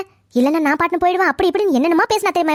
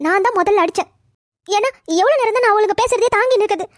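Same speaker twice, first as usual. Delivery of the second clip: usual speaking voice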